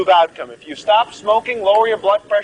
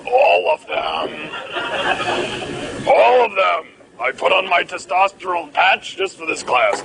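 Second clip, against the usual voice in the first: disguised deep voice. Retold or imitated. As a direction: growl it out like a hidden student faking a voice. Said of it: "he changes his voice".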